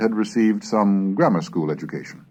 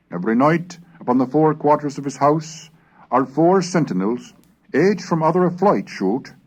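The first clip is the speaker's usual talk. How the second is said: put-on measured reading of an old chronicle; steady period narration; 6 semitones higher, 4 dB louder than usual. A narrator's reading. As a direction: read the archaic text like a storyteller. Reads Smith's history aloud.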